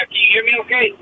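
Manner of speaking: distant speakerphone voice. Real, not acted. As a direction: far from the phone. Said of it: "Not really".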